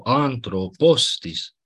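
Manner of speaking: Anthropostis said slowly, with stress on both -thro- and -tis; double-accented Greek word. Shown as a demonstration. Teacher shows students the ancient double accent, slowly stressed. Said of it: "ei chiar așa pronunțau".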